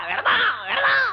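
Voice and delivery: high-pitched, exasperated call; exasperated yell. Acted. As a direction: yell it out for someone hard of hearing. Exasperated husband yelling to deaf wife. (విసుగ్గా గట్టిగా అరుస్తూ)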